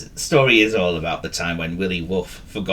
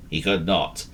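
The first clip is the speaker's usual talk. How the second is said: ordinary delivery, nothing marked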